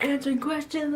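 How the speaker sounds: high, keen self-mocking voice; eager student. Recalling being right up in class, eager student. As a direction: pitch it up like a keen pupil.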